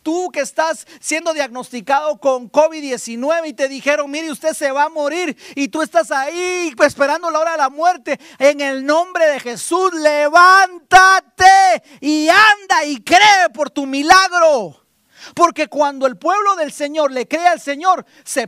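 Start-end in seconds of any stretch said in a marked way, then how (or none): 0.0-9.9 rising preacher's call to get up; full-force preaching. Acted. Pastor urging the sick congregation, fervent preaching. 9.9-13.5 high, loud, slow cry to rise; shouted command. Acted. Pastor commanding the sick to rise, slow shout. Climax of the altar call. 13.5-14.8 rising preacher's call to get up; full-force preaching. Acted. Pastor urging the sick congregation, fervent preaching.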